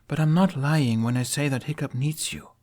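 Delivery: put-on earnest young warrior woman; lightly voiced woman, sincere; Astrid.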